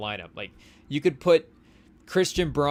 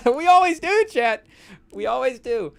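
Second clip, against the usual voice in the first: laughing; 10 semitones higher, 8 dB louder than usual. Pretend. Joking to imagined feds.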